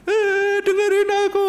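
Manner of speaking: high, loud whiny demand; mock demanding lover. A sweetheart demands her partner listen, in a mock high whine. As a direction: squeal it like a spoiled sweetheart.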